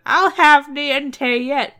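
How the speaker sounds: slow, loud, high threat; shout. Acted. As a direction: bellow the threat slowly. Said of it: "and shouted".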